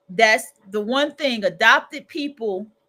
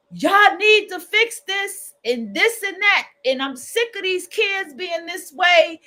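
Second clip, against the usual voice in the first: a fed-up relative barking orders at the household, hammed up; snapping at family; 7 semitones higher, 3 dB louder than usual. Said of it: "when somebody is snapping".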